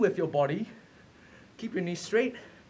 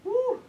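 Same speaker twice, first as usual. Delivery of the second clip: high whoop. Real, not acted. Coach cheering mid plank.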